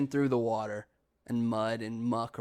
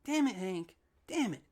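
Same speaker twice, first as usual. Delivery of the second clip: exasperated cussing; 8 semitones higher than usual. Retold or imitated. Older brother cussing at Hank in front of parents, exasperated. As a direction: imitate yourself swearing in frustration. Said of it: "heard me cuss like that".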